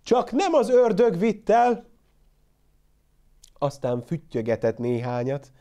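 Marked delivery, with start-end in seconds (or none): 0.0-1.9 high, loud anxious shout; shepherd fretting aloud about his goat; worried call. A narrator's reading. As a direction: call out, fearing the worst.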